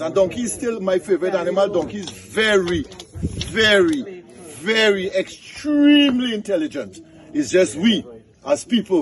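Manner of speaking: high-pitched, animated storyteller; folk-tale storytelling. Acted. Tells a donkey folk tale.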